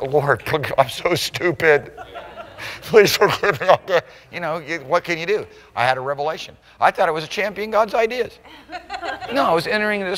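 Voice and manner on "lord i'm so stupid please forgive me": slow, half-laughing, mock self-reproach; laughing confession. Praying to the Lord after a revelation, laughing sheepishly. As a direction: laugh at yourself while asking forgiveness. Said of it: "and I'll go".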